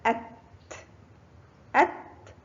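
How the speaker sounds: "أت" as short a plus crisp final t; sukun on taa. Shown as a demonstration. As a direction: say "أت" ending on a released t without a vowel. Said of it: "حرف التاء فوقه سكون ينطق". (نطق "أت" بتاء ساكنة)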